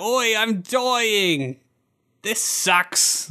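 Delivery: high, drawn-out Cockney wail; slow, hammed-up Cockney accent; mock Cockney accent. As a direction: overdo a Cockney dying complaint. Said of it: "the cockneyest of cockney accents".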